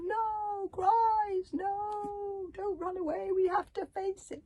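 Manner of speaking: high, pleading cry; a high, desperate call; crying after them. A narrator's reading. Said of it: "Zar crying after them".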